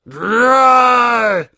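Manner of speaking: loud, high growling roar; full-throated monster roar; beastly roar. Acted. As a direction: let out a big angry growl at the bird. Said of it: "he roared".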